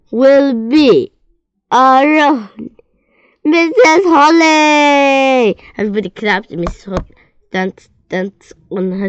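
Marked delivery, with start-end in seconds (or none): 0.1-5.6 big, high and loud, stretched out slowly; grand announcement. A narrator's reading. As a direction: announce it like a drumroll reveal. Dramatic announcement of the director.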